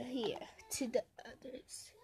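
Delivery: quiet whispered aside. Real, not acted. Cook whispering while placing food. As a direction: breathe the words out.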